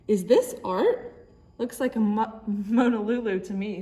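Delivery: a police chief scoffing loudly at the painting; gruff police chief. Acted. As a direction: boom it out like a doubting cop.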